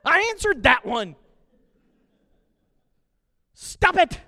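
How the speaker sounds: mock exasperated God. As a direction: answer like a fed-up parent. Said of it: "God in heaven is going".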